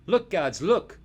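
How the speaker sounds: high, loud call down; boy calling mockingly to the guards below; taunting shout. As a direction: yell down to the guards. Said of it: "shouted Eric".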